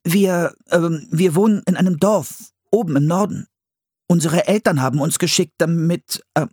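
put-on high, halting child's voice; improvising boy. A narrator's reading. Boy making up a story for the captain, hesitant. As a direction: pitch it high and stumble while inventing.

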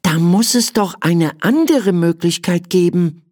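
put-on high child voice, pondering; thoughtful little boy. A narrator's reading. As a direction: think it over as a young boy. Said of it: "meinte Michael nachdenklich". (nachdenkliche Kinderstimme)

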